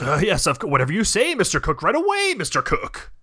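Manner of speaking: high, eager mock flattery; fawning yes-man. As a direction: gush like a groveling assistant. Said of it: "And they're like".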